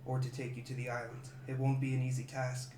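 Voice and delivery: soft, low-volume monk voice; a quiet monk's caution; hushed monk warning. Acted. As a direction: warn them quietly, gravely.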